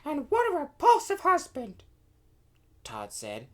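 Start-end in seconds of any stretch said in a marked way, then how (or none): 0.0-1.8 very high, loud little princess; disgusted princess. A narrator's reading. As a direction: exclaim in a high princess voice, appalled. Princess character voiced in story.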